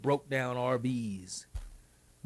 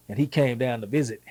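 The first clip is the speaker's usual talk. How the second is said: ordinary talk, nothing marked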